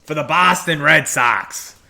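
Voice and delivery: slow, hammed-up Boston accent, loosely done; a clumsy try at a Boston accent, drawn out; deliberately slow, half-hearted Boston accent on the team name; bad Boston accent. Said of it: "I know that was a terrible Boston accent".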